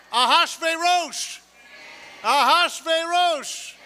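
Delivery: Ahashverosh stretched slow and loud; slow drawn-out name. Shown as a demonstration. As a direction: call out Ahashverosh slowly, every syllable. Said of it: "And if I say, "King Ahashverosh".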